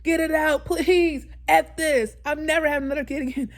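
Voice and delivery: high, loud re-enacted labor outburst; retold screaming. Mother re-enacts begging the doctor mid-delivery, high mock screaming. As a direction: act out yelling at the doctor in pain. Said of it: "And I was screaming".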